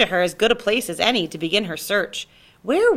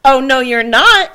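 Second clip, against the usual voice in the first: louder, slow bossy refusal; bossy big sister; 6 semitones higher, 12 dB louder, slower than usual. A narrator's reading. Sophie refusing her little brother, bossy and loud. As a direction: shut him down like a big sister. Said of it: "said Sophie".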